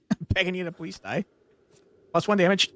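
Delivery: ordinary talk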